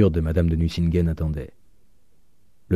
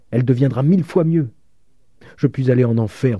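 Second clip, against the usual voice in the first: plus haut et plus fort, exalté; un vieux père qui déborde de fierté; fierté exaltée; 6 semitones higher, 4 dB louder than usual. A narrator's reading. Père fier vante sa fille. (fierté exaltée, plus haute et plus forte)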